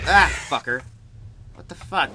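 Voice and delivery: loud, high shocked shout; startled yell. Real, not acted. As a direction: jump and yell out. Startled by game scare.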